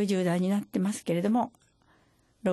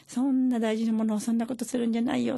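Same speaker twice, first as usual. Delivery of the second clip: mild scolding; 4 semitones higher than usual. Retold or imitated. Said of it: "ってお母さんがもう怒って".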